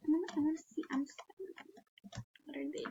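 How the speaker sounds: ordinary delivery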